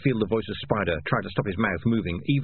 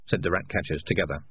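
ordinary delivery, nothing marked

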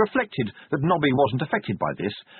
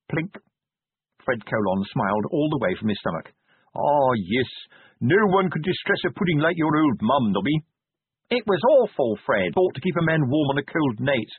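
rueful soldier; 6 semitones higher than usual. Acted. Nobby voiced, rueful soldier.

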